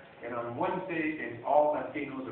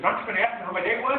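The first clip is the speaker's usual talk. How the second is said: higher, louder, fast demand; mock demanding wife; 7 semitones higher, 5 dB louder, faster than usual. Wife confronting husband while he cooks, fast and demanding. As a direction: fire it off like an impatient wife.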